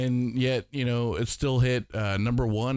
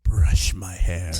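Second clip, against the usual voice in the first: seductive whisper; 4 semitones lower, 5 dB louder, slower than usual. Pretend. Seductive joke voices.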